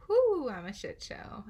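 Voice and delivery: loud slow self-mocking cry; exclaiming. Real, not acted. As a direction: blurt it out, laughing at yourself.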